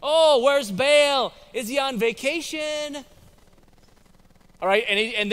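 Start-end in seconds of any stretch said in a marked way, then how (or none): 0.0-3.0 high, drawn-out mocking taunt; sarcastic Elijah. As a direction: draw it out slowly, taunting the rivals. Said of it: "Elijah is sarcastic".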